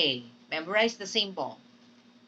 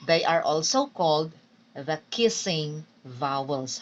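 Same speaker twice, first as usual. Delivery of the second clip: ordinary talk, nothing marked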